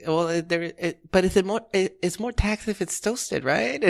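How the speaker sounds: high, hesitant imitation of an old customer; dithering old lady. Old lady puzzling over prices at the counter, high dithering voice. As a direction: stumble through it as a sweet old lady. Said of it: "a gazillion questions".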